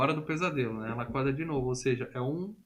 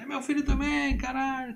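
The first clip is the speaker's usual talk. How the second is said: angry mother retort; 12 semitones higher than usual. Retold or imitated. Mãe furiosa responde ao marido.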